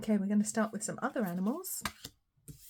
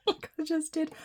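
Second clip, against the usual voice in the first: laughing; 8 semitones higher, 4 dB louder than usual. Real, not acted. Laughing after hitting her hand.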